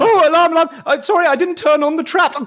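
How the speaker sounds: very high, loud yelp of alarm; punched scribe squeals alarm and apologises; panicked scribe. Acted. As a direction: cry out in flustered panic. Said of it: "he stumbles back".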